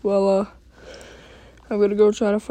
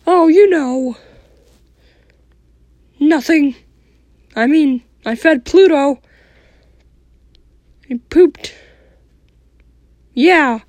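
high squeaky cartoon mouse; casual squeaky mouse voice; Mickey Mouse voice; 6 semitones higher, 5 dB louder than usual. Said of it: "Mickey, what did you do today?".

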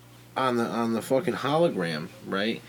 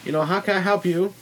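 higher, louder greeting from a shop lady; Chinese counter lady; 8 semitones higher, 3 dB louder than usual. Retold or imitated. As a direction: imitate the lady's high-pitched welcome. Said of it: "the Chinese lady at the counter is like".

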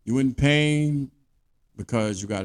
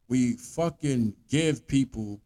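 ordinary talk

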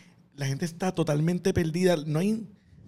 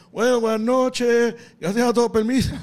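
louder, slow, hammed-up polite sign-off; awkward stage goodbye; 5 semitones higher, 6 dB louder than usual. Comedian bailing off stage, mock loud polite goodbye. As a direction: drag out a mock farewell to a crowd that isn't listening. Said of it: "yo suelto la guitarra y yo digo".

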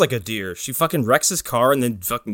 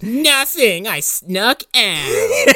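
high, drawn-out put-on girl voice; mock teenage girl; 11 semitones higher, much slower than usual. Daughter answering douchebag friends, high mock voice. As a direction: imitate a daughter, pitched way up and dragged out.

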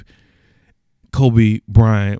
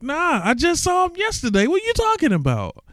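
much higher, incredulous protest; stunned disbelief. Retold or imitated. Friends hearing of LeBron rumor, protesting high and incredulous. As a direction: brush off the news as impossible.

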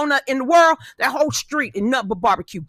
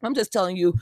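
usual speaking voice

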